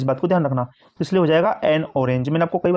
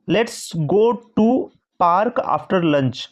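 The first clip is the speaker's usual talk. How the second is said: drawn-out dictation; slow example reading; much slower than usual. Shown as a demonstration. Teacher reading the example aloud slowly. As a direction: read it slowly, word by word. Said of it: "तो क्या बोला".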